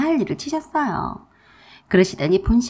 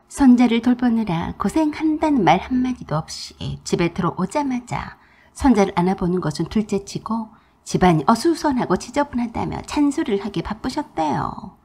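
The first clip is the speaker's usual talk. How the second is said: ordinary delivery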